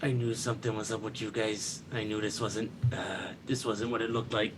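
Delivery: a cat murmuring his suspicion, quiet and low; the cat, hushed and knowing, low and soft; sly cat. Acted. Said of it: "just goes like".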